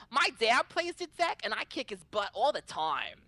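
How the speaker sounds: put-on high, loud boastful child voice; trash-talking kid. Boy boasting through the event, high cocky kid voice. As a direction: imitate a cocky little boy bragging. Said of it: "he was talking mad shit".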